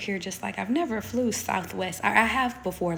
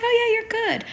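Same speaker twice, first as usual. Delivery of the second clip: high bright reassuring lady, louder; 9 dB louder than usual. Retold or imitated. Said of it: "She's like".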